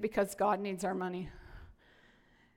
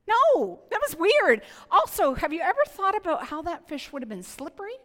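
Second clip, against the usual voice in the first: much higher and louder, animated; excited, amused; 8 semitones higher, 7 dB louder than usual. Real, not acted. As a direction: get worked up laughing at the weirdness. Joking about fish in mouths.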